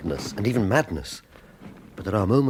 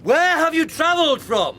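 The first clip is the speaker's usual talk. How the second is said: loud insistent hail; shouting; 6 dB louder than usual. Acted. Captain urges the stranger aboard, loud high shout. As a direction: shout the order high across the ice.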